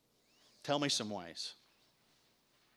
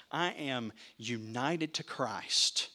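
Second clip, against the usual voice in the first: usual speaking voice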